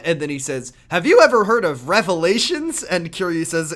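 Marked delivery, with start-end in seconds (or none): 0.9-2.8 louder, higher enthusiastic pitch; a light hammed-up evangelist question; eager photographer. Mac voiced in retold scene.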